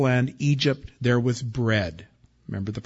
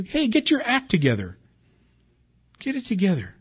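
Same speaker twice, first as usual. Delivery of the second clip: higher, sharper "Hey" from a fed-up father; exasperated dad; 8 semitones higher than usual. Retold or imitated. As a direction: bark it like a dad who's had enough of his sons. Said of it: "You ever have your dad say that to you?".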